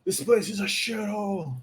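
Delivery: put-on high, grumbling Brummie accent; Birmingham accent. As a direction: moan like a Brummie. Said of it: "pretend you live in Birmingham".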